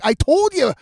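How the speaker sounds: loud, high-pitched told-you-so; exasperated scolding. Retold or imitated. Host scolding woman who needs a bathroom, exasperated.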